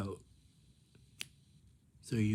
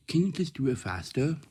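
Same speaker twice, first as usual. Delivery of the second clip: impatient grandma; 6 semitones higher, 8 dB louder than usual. Retold or imitated. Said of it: "my grandma pulls over".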